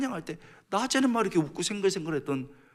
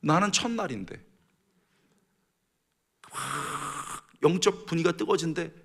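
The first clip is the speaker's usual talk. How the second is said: ordinary talk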